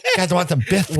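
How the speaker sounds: high lisping boxer voice, laughing; Mike Tyson impression. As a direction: lisp it like a famous boxer. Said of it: "Mike Tyson eating biscuits".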